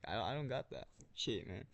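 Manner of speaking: ordinary talk, nothing marked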